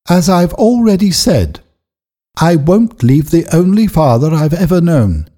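a daughter standing by her father; Eppie's firm young voice. A narrator's reading.

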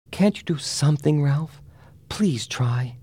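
high-pitched, breath-laden entreaty; pleading. A narrator's reading. Annabelle begs her fiancé to save the child, pleading.

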